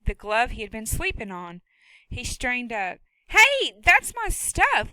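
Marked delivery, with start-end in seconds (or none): 3.3-4.9 loud, high, drawn-out protest; indignant shout. Acted. Boy yells at the woman going through his stuff, outraged. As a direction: yell it at her, outraged.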